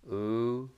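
U as a long "oo"; U sounded as a chant vowel; sound demonstration. Shown as a demonstration. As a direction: hold U as a sustained "ooo". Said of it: "collective of three sounds".

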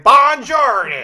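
loud, drawn-out mock Italian greeting; hammed-up American-accented Italian, high and slow; Brad Pitt's bad Italian. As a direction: belt out terribly faked Italian. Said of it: "Brad Pitt in Inglourious Basterds".

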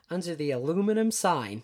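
hammed-up American car-ad pitch; American accent. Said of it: "this American guy selling cars".

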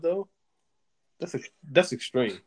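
ordinary talk

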